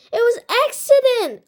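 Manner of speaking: high defensive protest. A narrator's reading. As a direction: insist it was an accident. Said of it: "I said".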